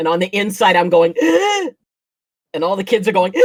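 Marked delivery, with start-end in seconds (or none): 1.2-1.7 high re-enacted gasp; sharp, high intake of breath; inward shock gasp. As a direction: gasp as if secretly horrified. Her inner shock at the pop.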